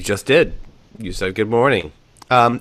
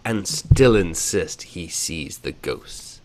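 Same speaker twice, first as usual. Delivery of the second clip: very slow, each sound spelled out; slow articulation; 3 semitones lower, much slower than usual. Shown as a demonstration. Therapist slowing the tongue twister down for the host, very slow. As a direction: speak it slowly like a speech therapist. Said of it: "That's speech therapy".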